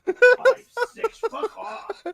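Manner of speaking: indignant yelp, high and loud; outraged. Acted. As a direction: bristle at the number. Outraged at weight.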